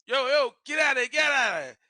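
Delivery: loud, high shout to scare it off; shouting at bear. Retold or imitated. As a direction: yell it out the car window. Yelling at bear from car.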